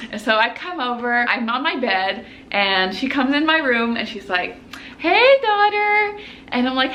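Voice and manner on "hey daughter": high, slow singsong hello; mom's greeting. Retold or imitated. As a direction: imitate a mom's drawn-out greeting.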